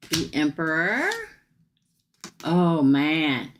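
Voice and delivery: normal talking voice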